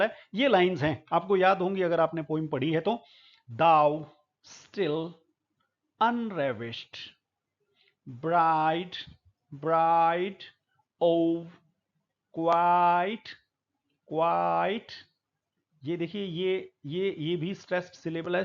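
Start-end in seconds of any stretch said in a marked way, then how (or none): 3.5-14.9 slow, measured reading of verse; poem recitation. Acted. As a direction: read the Keats lines with care. Recites Keats poem lines. (कविता का धीमा पाठ)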